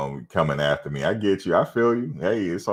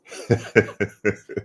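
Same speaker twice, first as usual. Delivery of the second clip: laugh; 6 semitones higher than usual. Real, not acted. Reacting to chat comment.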